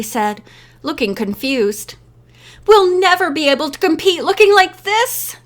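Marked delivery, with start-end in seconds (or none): 2.7-5.4 higher-pitched despairing complaint; moaning. A narrator's reading. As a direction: moan it in mild despair. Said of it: "Diana moaned".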